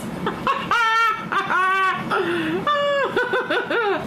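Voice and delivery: shrieking laugh, real. Real, not acted. Laughing off the girl's threat, a long, high shriek. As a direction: let out a long, high cackle.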